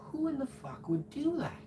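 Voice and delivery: high, disbelieving mock thought voice; incredulous inner voice. Alone, wondering who chased him, incredulous. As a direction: act out your own baffled thought.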